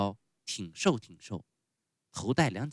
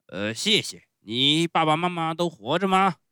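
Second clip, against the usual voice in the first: loud, higher ogre voice; gruff showman, questioning; 8 semitones higher, 9 dB louder than usual. Acted. Fire-eater asks Pinocchio about his parents, booming. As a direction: boom the question out.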